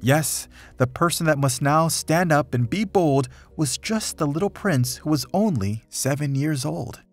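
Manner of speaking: plain reading voice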